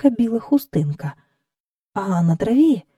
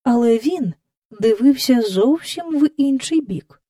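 usual speaking voice

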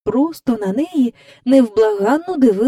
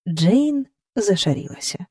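ordinary delivery

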